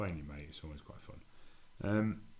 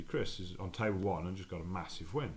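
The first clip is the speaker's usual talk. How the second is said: normal talking voice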